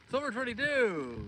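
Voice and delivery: high, loud, drawn-out shout-out; excited greeting. Real, not acted. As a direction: hail a friend, stretching the name out. Hails viewer joining the stream.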